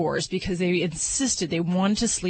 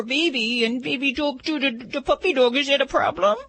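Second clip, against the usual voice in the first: put-on baby talk; 5 semitones higher than usual. Shown as a demonstration. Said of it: "baby talk demo for the puppy".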